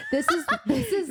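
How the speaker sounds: mock her through laughter; laughing mockery. Mocking Feyre's speech, laughing imitation.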